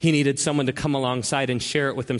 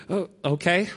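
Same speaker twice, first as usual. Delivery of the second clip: surprised; 4 semitones higher than usual. Retold or imitated. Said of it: "My first reaction was".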